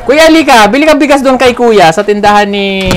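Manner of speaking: loud, high call to someone over there. Real, not acted. Calls someone over to buy rice.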